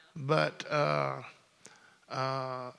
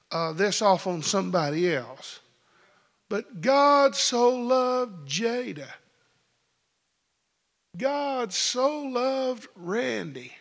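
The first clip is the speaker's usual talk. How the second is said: full-force preaching; 8 semitones higher than usual. Acted. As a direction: preach it out to the rafters.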